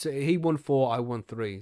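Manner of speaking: ordinary talk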